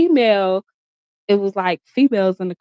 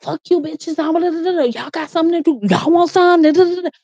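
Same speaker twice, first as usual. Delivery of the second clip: mock angry yell; 8 semitones higher, faster than usual. Boyfriend cursing out the girls in the car, high mock angry rant. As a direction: bark at the girls in his voice. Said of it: "coming at the girls".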